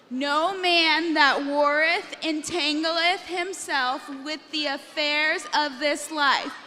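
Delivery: loud, slow army-drill chant; marching recital. Shown as a demonstration. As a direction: recite it in measured, punchy beats. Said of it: "You guys are an army".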